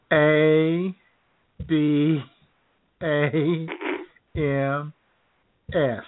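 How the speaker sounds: each letter spelled slowly with pauses; slow spelling out. Retold or imitated. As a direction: spell it out letter by letter like a poll worker. Poll worker spelling name slowly.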